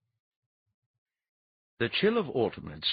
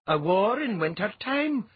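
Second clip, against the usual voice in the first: higher, louder doubtful question; dubious; 13 semitones higher, 6 dB louder than usual. A narrator's reading. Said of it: "Kaleka Ironjaw said dubiously".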